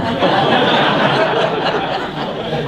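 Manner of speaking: a high, breathy burst of laughter; hearty chuckle; amused laugh. Real, not acted. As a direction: laugh at your own punchline. Laughs at turkey husband joke.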